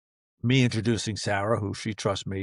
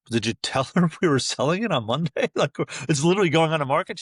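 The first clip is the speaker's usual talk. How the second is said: laughing through the question, rising higher; laughing disbelief; 5 semitones higher than usual. Retold or imitated. Husband asking his wife, laughing in disbelief. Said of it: "I go".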